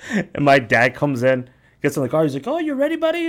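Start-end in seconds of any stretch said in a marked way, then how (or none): 2.5-3.3 high, sing-song fatherly cheer; mock cheerful dad voice; cheery dad. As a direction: chirp it like an upbeat dad.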